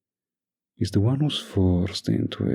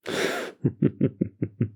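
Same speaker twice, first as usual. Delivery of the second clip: short run of light chuckles; 6 semitones higher than usual. Acted. Boss amused by doll's pushback, chuckling.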